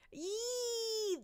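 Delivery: long, high, soft squeal; delighted squeal. Real, not acted. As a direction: let out a drawn-out squeal of glee. Delighted at being called oshi.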